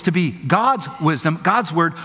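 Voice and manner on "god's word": ordinary talk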